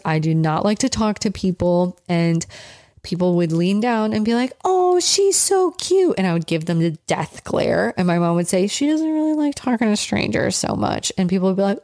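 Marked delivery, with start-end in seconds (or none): 4.7-6.2 very high, slow gushing coo; cooing stranger. Retold or imitated. Stranger leaning down to a shy child, cooing. 8.7-11.1 higher-pitched apologetic mom voice; polite mother. Retold or imitated. Mother to strangers about her shy child, politely protective.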